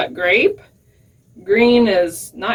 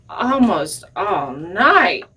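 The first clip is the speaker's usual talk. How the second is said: plain reading voice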